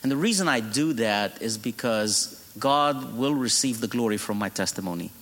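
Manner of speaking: normal talking voice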